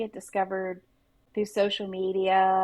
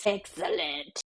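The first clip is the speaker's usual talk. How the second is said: Mr. Burns impression; 4 dB quieter, slower than usual. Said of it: "The Mister Burns".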